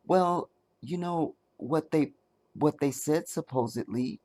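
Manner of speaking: [name] impression. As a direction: ease it out gently, almost hiding the words. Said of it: "my best [name] impersonation".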